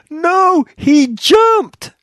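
very high, loud mock scream; retold scream. Woman screaming up to the balcony about the dog, mimicked shrill scream. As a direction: imitate a screaming woman, pitch shooting way up. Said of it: "And she screams".

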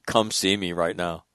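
low, understated officer's command; retold stern order. Retelling his order to the culprit, quietly stern. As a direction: summon him with quiet authority.

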